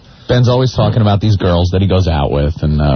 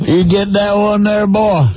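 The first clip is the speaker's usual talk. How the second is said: high-pitched hammed-up Southern accent; 11 semitones higher than usual. Shown as a demonstration. Co-host shows Opie how his question should sound, hammed-up Southern accent. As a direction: tease with a broad, higher-pitched Southern accent. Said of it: "said that with a southern accent".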